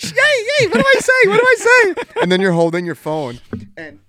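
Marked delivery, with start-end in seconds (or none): 0.0-1.9 very high squeaky mock panic, much louder; high-pitched teasing impression; mock squeal. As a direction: squeal it like a giddy teen who doesn't know what to text. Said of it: "You're like".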